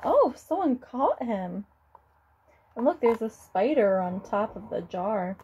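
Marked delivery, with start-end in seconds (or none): none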